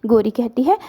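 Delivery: usual speaking voice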